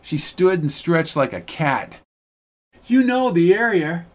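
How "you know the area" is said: high-pitched classy lady. A narrator's reading. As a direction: speak up high with refinement. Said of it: "She spoke in a high, classy voice".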